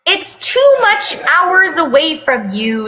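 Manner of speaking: lightly whiny, clingy son; whining. Acted. A hint of Taehyung whining to his mom. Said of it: "Taehyung whines".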